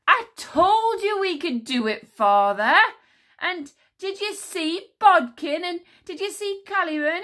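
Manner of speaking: put-on high, loud triumphant boyish shouting; triumphant cry. Tsar boasting to his father, high triumphant shout. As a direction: punch the air with your voice. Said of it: "He cried".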